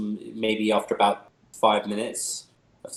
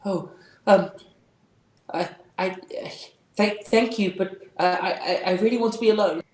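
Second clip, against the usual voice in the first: much higher, halting and timid; shaken girl; 8 semitones higher, 5 dB louder than usual. Acted. Calithia refusing the visitor, shaky and timid. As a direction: stammer out a refusal.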